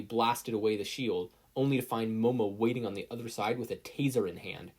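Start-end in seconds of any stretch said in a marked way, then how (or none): none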